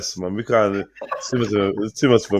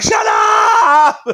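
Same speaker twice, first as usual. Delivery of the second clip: shouting; 11 semitones higher, 4 dB louder than usual. Retold or imitated. Retold yelling at chatters.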